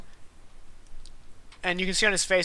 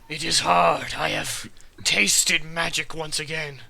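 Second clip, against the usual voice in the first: talking sword; slower than usual. Acted. Sword voice, slow.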